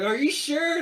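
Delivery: high-pitched questioning quote; imitating unsure kids; mock doubtful question. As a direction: ask it high, as a doubting kid. Voices hesitant kids questioning leader.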